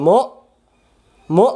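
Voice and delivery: মু loud and abrupt with glottal stop; hard hamza stop. Shown as a demonstration. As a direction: punch মু and cut it short. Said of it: "পড়তে হয়". (মু শক্ত করে পড়া)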